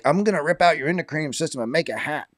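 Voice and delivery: a lightly voiced crazed actor, fast; mock unhinged rant. A crazed actor threatening the interviewers, lightly imitated and fast. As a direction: rattle off a wild threat. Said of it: "He's saying things like".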